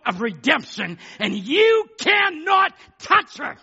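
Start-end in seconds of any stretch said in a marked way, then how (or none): 1.2-3.6 high, slow, forceful warning; defiant preaching shout. Real, not acted. Preacher warning the devil off the elected lady, defiant shout. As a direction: hammer it out word by word.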